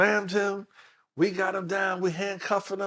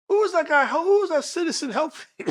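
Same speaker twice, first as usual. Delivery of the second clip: higher, louder surprised question; puzzled prosecutor; 12 semitones higher, 4 dB louder than usual. Retold or imitated. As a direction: ask in disbelief. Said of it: "district attorney goes".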